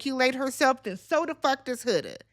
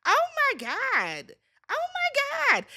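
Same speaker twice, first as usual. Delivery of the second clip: very high, drawn-out exasperation; exasperated; 13 semitones higher, slower than usual. Real, not acted. Host fed up with Huda's complaints, high exasperated cry. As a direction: let the words stretch up high in sheer exasperation.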